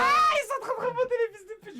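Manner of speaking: high, loud outburst of dismay; shocked shout. Real, not acted. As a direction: yell in disbelief. Voit [name] au sondage. (cri aigu de consternation)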